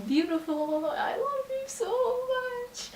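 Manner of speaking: very high, drawn-out lovey-dovey squeal; mock gushing. Girlfriend teasing boyfriend after he says cute, mock adoring. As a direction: gush at him in a slow, exaggerated high voice. Gushes mockingly at boyfriend's 'Cute'.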